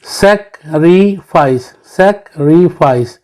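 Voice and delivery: Sacrifice slowly in three beats, twice; teacher spacing out each syllable of sacrifice; split into syllables. Shown as a demonstration. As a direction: break sacrifice as सैक-री-फाइस. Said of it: "ये three syllable है".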